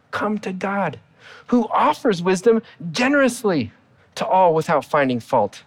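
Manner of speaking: normal talking voice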